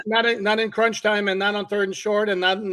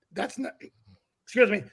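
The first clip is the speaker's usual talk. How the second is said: choked up; 4 semitones higher, 5 dB louder than usual. Real, not acted. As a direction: voice catching, needing to clear the throat. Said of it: "I'm getting all choked up".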